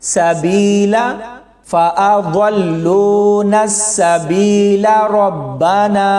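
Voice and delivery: slow recitation of the verse; Quranic recitation. Shown as a demonstration. Teacher reciting the full verse, slow recitation. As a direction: recite the phrase slowly and evenly. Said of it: "तो ऐसे पढ़ेंगे".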